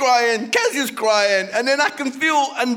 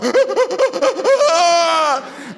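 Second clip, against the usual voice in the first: loud, high sobbing wail; exaggerated heaving sobs, loud and high; mock bawling; 8 semitones higher, 6 dB louder than usual. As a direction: bawl properly, hammed up. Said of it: "I'm talking proper".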